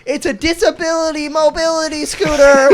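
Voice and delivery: high-pitched imitation of a woman protesting; high, put-on complaining voice; mock indignation. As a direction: mimic her aggrieved catchphrase. Mimicking her catchphrase.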